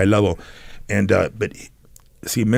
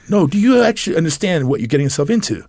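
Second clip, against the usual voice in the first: louder, pressing taunt aimed right at him; mock pushy taunt; 8 semitones higher, 5 dB louder than usual. Guy in the hotel lobby needling him, louder, mock-pushy. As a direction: needle him about how badly he'll lose.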